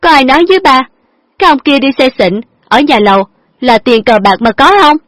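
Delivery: high, loud reproach; heated lecturing. A narrator's reading. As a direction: drive the point home angrily. Daughter lectures father.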